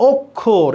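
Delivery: "অক্ষর" split as "ok-khor", first vowel rounded "o"; broken syllables. Shown as a demonstration. Teacher showing where the word stops, "অক্ষর" broken into "ok-khor". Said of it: "এখানে কি আমি break করছি না".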